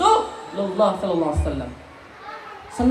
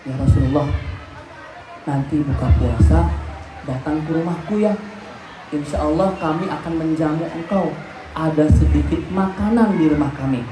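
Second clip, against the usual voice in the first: low, hushed invitation in a lowered voice; lightly voiced secret invite, lower; whispered invitation; 9 semitones lower than usual. Jabir secretly inviting the Prophet, low hushed voice. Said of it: "kata Jabir sambil berbisik".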